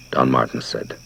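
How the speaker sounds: ordinary delivery, nothing marked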